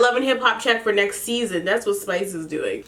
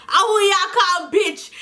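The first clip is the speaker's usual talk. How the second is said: slow, higher put-on Jamaican Patois; mock Patois; 10 semitones higher, slower than usual. Imitating [name] retorting slowly in Jamaican Patois. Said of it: "cussing in Patois".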